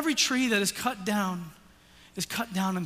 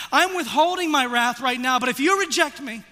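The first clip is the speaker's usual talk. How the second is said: loud, high, driving sermon voice; fiery preaching; 6 semitones higher, 7 dB louder than usual. Acted. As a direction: press the guilt home from the pulpit. Preaching at full force.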